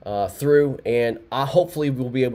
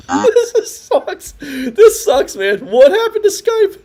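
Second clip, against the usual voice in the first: much higher and louder, laughing; laughing exasperated; 12 semitones higher, 5 dB louder, slower than usual. Real, not acted. Host exasperated at Skype failing, laughing. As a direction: laugh in disbelief at the broken call.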